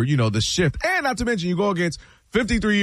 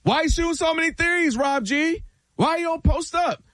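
much higher, needling critic voice; mock heckling fan; 11 semitones higher than usual. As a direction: needle him like a fed-up fan. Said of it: "What's the first thing they say?".

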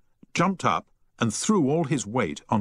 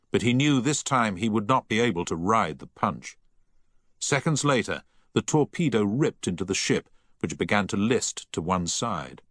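ordinary delivery

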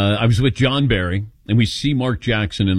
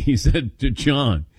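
ordinary talk, nothing marked

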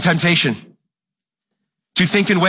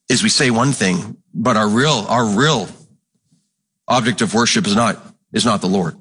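usual speaking voice

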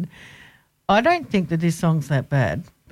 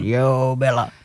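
odd delivery; 5 semitones lower, slower than usual. Retold or imitated. Host puzzled at own wording, oddly drawn out. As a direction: repeat the drawn-out, low "Yo Bella". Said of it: "I don't know why I said".

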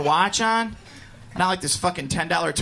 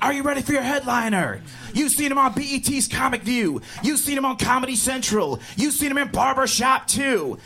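imitating a hype club host, higher, building to a shout; 8 semitones higher than usual. Comic imitating the host introducing the headliner, hyped shout. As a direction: pump up the crowd like an MC. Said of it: "The host was like".

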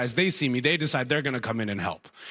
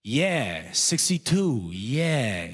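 put-on drawn-out, clueless old man ad-lib; senile older guy; 3 semitones lower, much slower than usual. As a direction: echo along with no idea what's happening.

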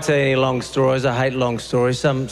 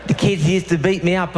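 ordinary talk, nothing marked

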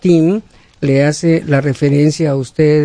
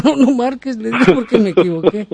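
laughing; 5 semitones higher, faster than usual. Real, not acted. Laughing at his own mistake.

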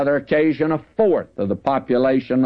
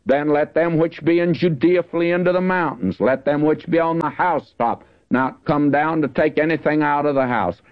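raised, measured Bible reading; scripture reading; 4 semitones higher than usual. Acted. Preacher reading Matthew to the congregation, raised and solemn. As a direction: read it out as holy writ, lifted up.

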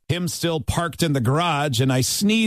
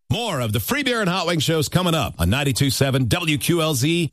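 upbeat radio ad read; 5 semitones higher than usual. Acted. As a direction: sell it like a radio spot.

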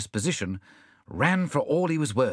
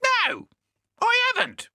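very high, curt parrot; high-pitched parrot retort; 4 dB louder than usual. A narrator's reading. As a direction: answer in a piercing bird squawk. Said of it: "said the parrot".

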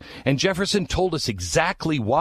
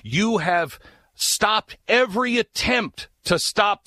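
higher, slow indictment; accusing Jefferson; 8 semitones higher, slower than usual. Retold or imitated. Jefferson accusing King George, higher and slow. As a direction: spell out the charge to the King. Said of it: "he goes on and he says".

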